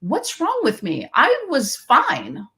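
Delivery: normal talking voice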